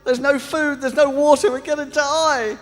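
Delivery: high, loud panicked yelling; stranded castaway losing it, screaming in panic. Retold or imitated. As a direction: scream it like a man sure he'll die. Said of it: "started screaming and yelling".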